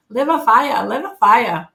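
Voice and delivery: a loud, lightly mocking Chinese accent; bad Chinese accent. Quoting her Chinese medicine doctor on her liver, bad Chinese accent. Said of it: "That's a bad Chinese accent".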